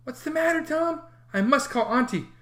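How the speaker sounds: put-on high, anxious boy's voice; frightened brother pleading; alarmed younger boy; worried. Sid anxious over Tom.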